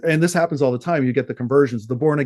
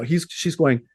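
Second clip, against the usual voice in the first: normal talking voice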